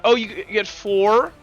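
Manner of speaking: ordinary talk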